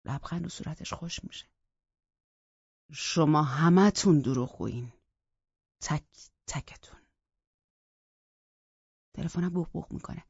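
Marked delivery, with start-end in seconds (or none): 2.9-7.0 slow, heavy, fading to a hiss; bitter accusation. Acted. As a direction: spit out the accusation. (اتهام تلخ و کند)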